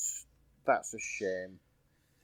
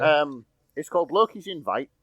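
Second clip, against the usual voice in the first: Yorkshire accent. Shown as a demonstration. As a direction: own plain Yorkshire voice. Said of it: "I'll try that again in my, uh, usual sort of Yorkshire brogue".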